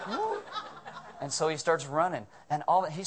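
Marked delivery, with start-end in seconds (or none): 0.1-0.4 a much higher, awed "Whoa."; amazed whoa. Retold or imitated. Crowd watching the line part for Jake, high awed whoa. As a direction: let the wonder jump out high. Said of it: "It's like".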